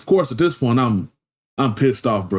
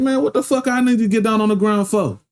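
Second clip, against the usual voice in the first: higher, defiant protest; indignant; 9 semitones higher than usual. Retold or imitated. Man protests being told to get on the ground, higher and indignant. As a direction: push back on the cop. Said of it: "I'm like".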